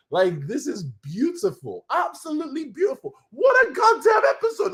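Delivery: high, teary voice building to a shout; emotional rave. Real, not acted. Fan raving to viewers about the finale, teary then shouting. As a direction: gush until it breaks into a yell. Overwhelmed by the episode.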